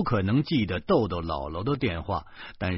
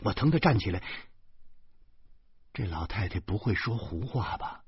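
ordinary delivery

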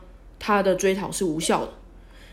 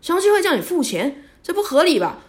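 high, louder incredulous outburst; shocked; 9 semitones higher, 5 dB louder than usual. Retold or imitated. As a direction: protest in high-pitched disbelief. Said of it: "听了以后就是大惊".

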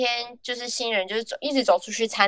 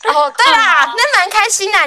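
high, loud cheerful burst; happy cheer; 12 semitones higher, 7 dB louder, slower than usual. Real, not acted. Glad she is leaving the job, loud and cheerful. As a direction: brighten up suddenly, louder.

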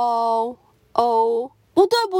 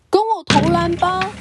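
high, bright greeting; cheerful tiger; 8 semitones higher, 4 dB louder than usual. Acted. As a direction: sing out a hello. Crocodile invites.

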